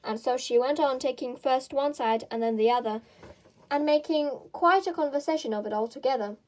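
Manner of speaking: usual speaking voice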